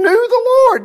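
soaring high and loud on the Lord; exclaimed high. Real, not acted. Preacher lifting his voice to the Lord in praise. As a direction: lift it up in praise.

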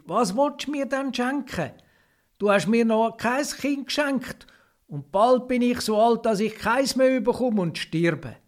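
ordinary delivery

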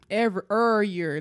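put-on St. Louis accent, "Every" then "er year" with the "Every" clipped to "er". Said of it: "Let me bring out my Saint Louis accent".

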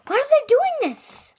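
put-on puzzled old lady up the tree; confused grandma.

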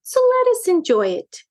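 high, cheerful lilt. A narrator's reading. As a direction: chirp it cheerfully as mother cat. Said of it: "broke in Madame Meow cheerfully".

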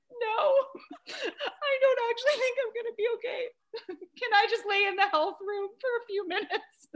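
very high tearful whine through laughter; mock sobbing. Mom telling the secretary she's not okay, mock sobbing. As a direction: wail pitifully, hammed up and half laughing. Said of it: "We're both crying".

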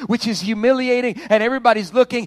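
loud, high, rising preacher cadence; preaching at full force. Real, not acted. Pastor preaching hope to a hurting congregation, full force. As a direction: drive it home to the church.

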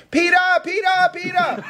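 very high-pitched excited call; Charlie crying out. Retold or imitated. Son Charlie calls to Peter across campus, crying out. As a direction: imitate a kid yelling across the campus. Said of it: "your son Charlie cries out to me".

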